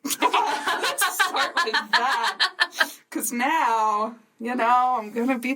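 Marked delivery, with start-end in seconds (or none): 0.0-2.4 high, slow words through giggles; laughing. Real, not acted. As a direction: joke to open, breaking into laughter. Laughing about own age.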